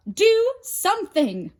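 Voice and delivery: high loud urgent call; yelling. A narrator's reading. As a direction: yell his name. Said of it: "yelled Hera".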